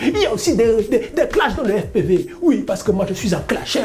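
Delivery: hammed-up angry clasher rant, pitched high; mock worked-up. Self-styled FPV clasher addressing his viewers, mock worked-up. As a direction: play at being outraged, with exaggerated emphasis. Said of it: "Vous avez vu comment je suis énervé".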